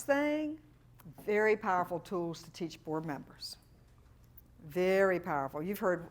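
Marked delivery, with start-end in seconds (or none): none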